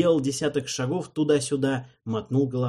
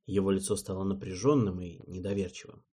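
usual speaking voice

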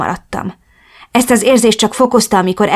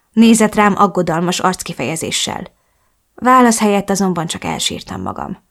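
plain reading voice

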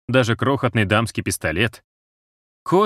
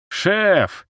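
high cheering shout; 11 semitones higher than usual. A narrator's reading. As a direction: call out across the hall. Soldiers cheer the chief.

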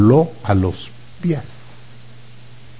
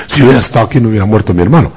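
rattled off at double speed; rushed complaint; 4 semitones higher, much faster than usual. Acted. Martha confronts the Lord about her brother, rushed complaint. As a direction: blurt it out fast as a reproach.

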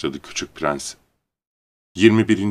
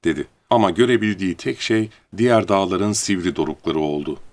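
usual speaking voice

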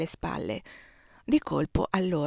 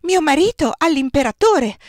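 thundered out, much higher and louder; thundering; 8 semitones higher, 9 dB louder than usual. A narrator's reading. Marshal's wife thunders her husband's threat at Désirée, loud. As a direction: boom it across the salon. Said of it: "tuonò la marescialle".

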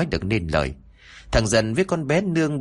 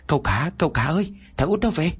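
higher, fast urgent call to his master; servant calling out; 7 semitones higher than usual. Acted. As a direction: shout the warning in from outside, quick.

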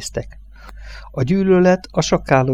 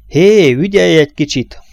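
higher, louder call-out; sharp raised-voice warning; shouted warning; 6 semitones higher, 6 dB louder than usual. A narrator's reading. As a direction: yell it across the workshop. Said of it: "kiáltott rá a fényszoron dolgozó munkás".

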